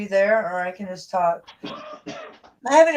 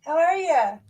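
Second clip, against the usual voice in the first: much higher happy hello; excited greeting; 13 semitones higher, much slower than usual. Real, not acted. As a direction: sing out a greeting. Greeting a new arrival.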